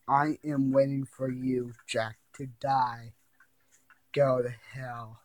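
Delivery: low, slow menacing murmur; a hushed, ghostly warning; spooky low voice. Acted. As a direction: creep them out with a slow, low threat. Creepy game voice again.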